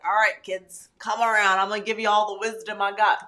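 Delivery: put-on a touch of a storytelling grandma calling kids round; wise grandma. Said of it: "I wanna be the grandma".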